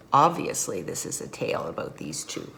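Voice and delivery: usual speaking voice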